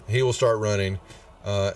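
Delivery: ordinary talk, nothing marked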